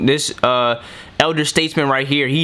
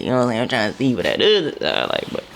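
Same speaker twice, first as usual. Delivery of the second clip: lightly voiced old-timer, trailing lower; mock ninety-year-old; 4 semitones lower, faster than usual. Host imagining ninety-year-olds ready for the other side, lightly mocked elderly voice. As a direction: let it sink into a hint of an elderly mumble. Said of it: "'cause they all ninety".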